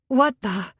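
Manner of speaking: high, loud startled gasp; sudden breathless shock. Acted. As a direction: blurt it out as if punched in the gut. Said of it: "she gasped".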